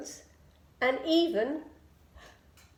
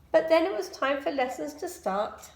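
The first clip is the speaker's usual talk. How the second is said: usual speaking voice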